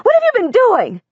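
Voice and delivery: high-pitched, alarmed and tense; a frightened high question; high shaky voice. A narrator's reading. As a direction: press the child with rising worry. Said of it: "Her voice is high and shaky".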